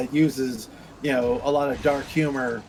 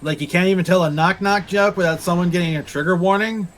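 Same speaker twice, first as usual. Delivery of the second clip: ordinary delivery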